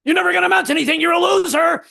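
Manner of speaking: very high, loud taunting demon voice; morning demons. Pretend. Inner demons taunting him on waking, high and loud. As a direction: jeer at the sleeper. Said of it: "little voices inside your head".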